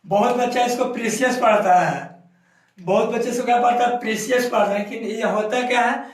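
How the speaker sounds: ordinary talk, nothing marked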